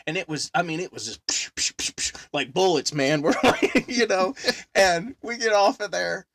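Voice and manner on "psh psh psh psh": unvoiced bursts of flying coins, psh; imitated bullet noises. Describing change flying on the ride, imitated bullet noises. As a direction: spit out quick whooshing impact sounds.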